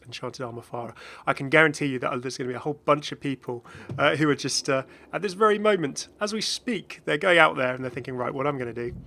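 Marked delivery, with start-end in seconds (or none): none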